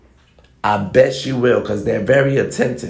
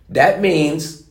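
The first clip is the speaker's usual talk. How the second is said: ordinary talk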